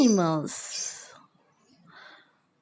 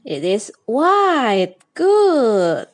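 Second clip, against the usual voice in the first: usual speaking voice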